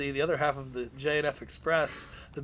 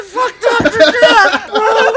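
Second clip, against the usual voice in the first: very loud, much higher, slow yell; defiant shout; 14 dB louder, much slower than usual. Retold or imitated. Ray screaming at the boss in defiance, full shout. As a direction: scream it right back at the boss.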